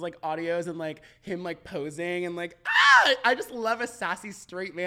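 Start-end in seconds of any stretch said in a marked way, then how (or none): 2.7-3.1 short, very high, loud squeal; excited yelp. Retold or imitated. Fan reacting to thirst audios, mock swooning squeal. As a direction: squeal at the top of your voice.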